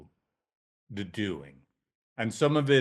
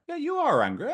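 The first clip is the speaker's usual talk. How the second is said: validating therapist. Shown as a demonstration. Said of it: "you do that through validating them".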